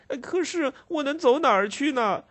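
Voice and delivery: put-on very high, tearful child's wail; crying plea. Ragged boy crying to the constable, very high and tearful. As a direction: sob the question out helplessly. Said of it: "那孩子哭着说".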